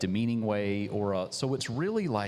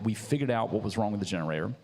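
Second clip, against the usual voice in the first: ordinary talk, nothing marked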